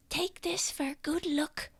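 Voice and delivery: high, soft whisper; whispering little child. Acted. Child handing adventurer a charm, high soft whisper. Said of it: "and she says".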